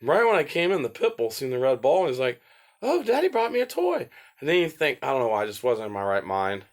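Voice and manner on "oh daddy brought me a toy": very high, excited puppy voice; squeaky delighted pet; happy dog voice. Pretend. Voicing the pit bull.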